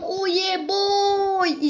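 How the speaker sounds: drawn out, very slow and smug; slow catchphrase. Pretend. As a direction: stretch the catchphrase out like a proud show-off. Catchphrase after a jump. (yavaş, keyifli nara)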